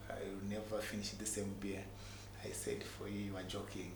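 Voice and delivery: ordinary delivery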